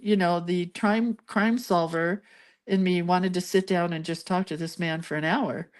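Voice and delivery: ordinary talk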